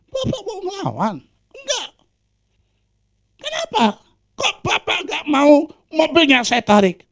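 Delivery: very high, mock-indignant collector voice; challenging collector. Debt collector asking why he resists, high mock voice. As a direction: squeak out the challenge.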